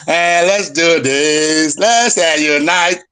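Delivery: drawn-out, wheedling mock appeaser; mockingly slow and drawn out. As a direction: imitate a slow, placating do-nothing. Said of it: "this is not time to dilly-dally".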